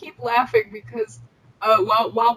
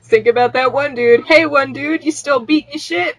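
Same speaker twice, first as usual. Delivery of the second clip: loud, higher mock call to a dude; 3 semitones higher, 6 dB louder than usual. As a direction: holler across at him. Calling out to a guy.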